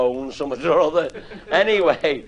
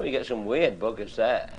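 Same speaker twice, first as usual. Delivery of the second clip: ordinary talk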